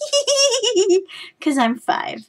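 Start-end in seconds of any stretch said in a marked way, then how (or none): 0.1-1.1 put-on childish giggle. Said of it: "I'm like".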